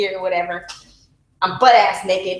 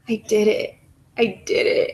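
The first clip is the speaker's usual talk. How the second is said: tearful. Retold or imitated. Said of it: "tears were caught falling down".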